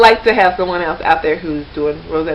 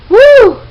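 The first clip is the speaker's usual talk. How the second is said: excited whoop; 12 dB louder than usual. Real, not acted. Reacting to horrendous pronunciation drill.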